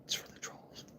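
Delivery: teasing whisper. Acted. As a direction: hush it like a secret. Hushed aside about the troll toy.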